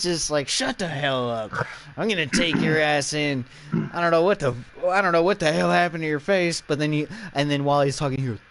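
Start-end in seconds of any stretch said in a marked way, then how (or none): 0.5-6.6 loud, higher-pitched mock scolding; angry cop. Cop to Ass Face in the car, acted angry outburst. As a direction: bark it like a fed-up officer in a retelling. Retold cop snapping at prisoner.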